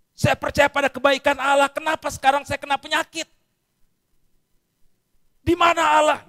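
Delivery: high, loud protest at God; protesting complaint. Retold or imitated. Sick person protesting loudly to God. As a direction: cry out that God has gone missing.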